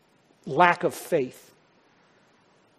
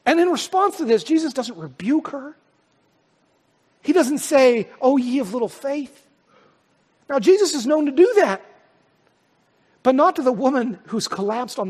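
raised, emphatic sermon voice; louder, driving pulpit delivery; forceful preaching; 9 semitones higher, 6 dB louder than usual. Acted. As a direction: preach it out with mounting urgency.

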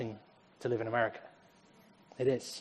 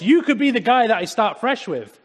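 a touch louder, higher tempting offer; lightly voiced God; 8 semitones higher, 9 dB louder than usual. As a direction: make it sound like a grand promise. Voicing God's tempting offer.

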